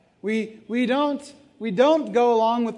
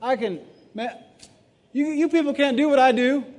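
a hint of a cocky bragger, higher and louder; lightly hammed-up swagger; preacher mocks an imagined bragging Paul, in a mildly cocky voice; mock boastful Paul; 5 semitones higher, 4 dB louder than usual. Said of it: "for Paul to be like".

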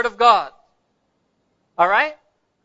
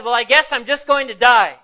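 put-on exasperated boy, louder and raised; quite annoyed; 3 semitones higher, 5 dB louder than usual. Boy fed up with the old pastor's questions, acted exasperation. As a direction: throw the answer back in acted exasperation. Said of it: "the boy now was quite annoyed".

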